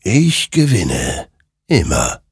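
slow, ghostly final boast; ominous murmur. A narrator's reading. The rider tells Hektor who always wins, slow and ominous. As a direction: let it sink, slow and ominous. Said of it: "murmelte dieser".

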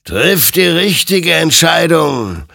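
langsam, hoch und laut mahnend; laute Ermahnung. A narrator's reading. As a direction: mahne mit gedehnter, erhobener Stimme. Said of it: "ermahnte ihn sein Gegenüber". (eindringlich mahnendes Rufen)

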